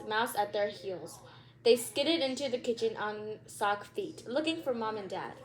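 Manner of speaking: ordinary delivery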